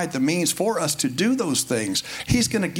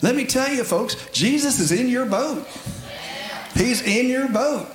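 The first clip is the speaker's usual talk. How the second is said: high-pitched, urgent pulpit proclamation; raised preacher's pitch, firmly emphasized; emphatic preaching; 9 semitones higher than usual. Real, not acted. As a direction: drive the promise home to the congregation.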